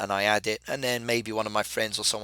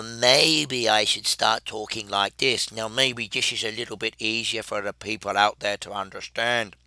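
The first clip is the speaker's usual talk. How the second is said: completely different voice. Shown as a demonstration. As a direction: put on a comic, altered way of talking. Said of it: "talking in a completely different voice".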